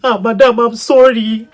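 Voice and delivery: pleading driver. Retold or imitated. Driver begging crying pregnant wife.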